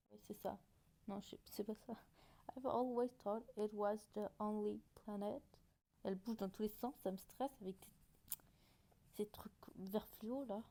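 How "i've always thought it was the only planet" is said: English read with a French accent; deliberate reading in French-accented English. Shown as a demonstration. Vlogger reads out her old subtitles, French accent. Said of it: "observez mon bel accent anglais".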